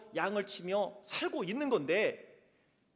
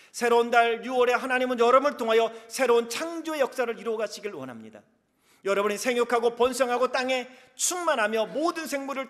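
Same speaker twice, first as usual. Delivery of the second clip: 열정적 설교; 4 semitones higher, 8 dB louder than usual. Acted. 힘차게 설교하며 축복을 선포.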